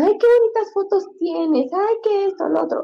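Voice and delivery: high-pitched mimicry of gushing compliments; gushing admirers. People praising her photos, high gushing mimicry. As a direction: imitate people cooing in a high voice. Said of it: "siempre me dicen".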